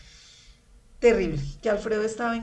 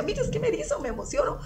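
thrilled goosebumps; 10 semitones higher, faster than usual. Retold or imitated. Husband imagining meeting the Virgin.

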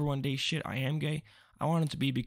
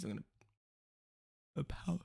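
words stretched by a yawn; 4 semitones higher, 9 dB quieter, much faster than usual. Real, not acted. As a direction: talk through a yawn. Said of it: "oh, it's a yawn".

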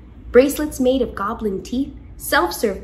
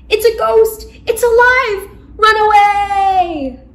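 high, loud terrified shriek; panicked scream; 10 semitones higher, 9 dB louder than usual. Acted. Ghost terrified by a hand on his shoulder, screaming. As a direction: yell it in pure panic.

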